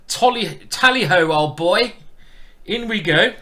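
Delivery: loud, high tally-ho cry; playful toff battle cry; hammed-up old-boy gentleman. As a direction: call the charge like a mock huntsman. Mock gentleman's charge into attack.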